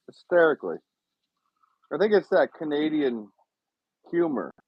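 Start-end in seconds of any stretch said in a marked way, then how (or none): none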